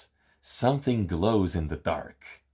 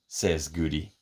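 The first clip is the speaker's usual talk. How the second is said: ordinary delivery, nothing marked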